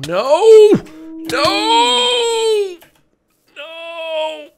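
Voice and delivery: high desperate wail; crying out in despair; anguished cry. Real, not acted. Dying in game.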